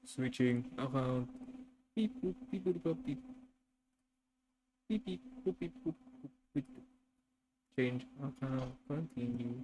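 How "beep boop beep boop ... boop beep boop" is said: higher playful beep boop; sing-song computer beeps; mock robot beeps. Switching account, mock computer noises.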